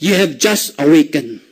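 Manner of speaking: low and slow, with weight on each word; measured, deep-pitched pronouncement; ominous parting line. Acted. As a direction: deliver it like a grave warning. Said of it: "ano sabi mo?".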